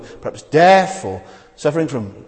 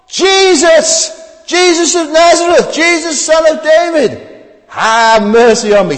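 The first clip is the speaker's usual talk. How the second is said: very high, loud shouted plea; desperate yell; 9 dB louder, slower than usual. Acted. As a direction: yell it over the crowd. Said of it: "he yelled".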